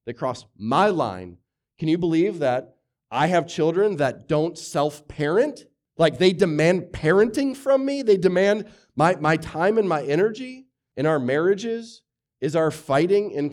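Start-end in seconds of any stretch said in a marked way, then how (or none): 1.8-10.7 mock exasperated parent. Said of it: "I'm angry because they broke my law".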